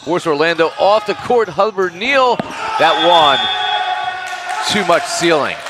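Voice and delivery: high and loud, building through the rally; excited rally call. Real, not acted. Announcer calling the rally to viewers, high and loud. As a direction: ride the rally with the crowd.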